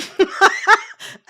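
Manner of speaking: high burst of laughter; quick high giggling laugh. Real, not acted. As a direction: crack up at your own confession. Amused by confessing sabotage.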